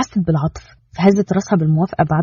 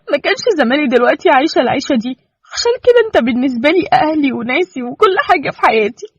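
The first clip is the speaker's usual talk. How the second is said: higher, emotional wife, near tears; voiced wife, tender; 8 semitones higher than usual. Wife tenderly telling Kenan he is everything to her. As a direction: confess it with feeling.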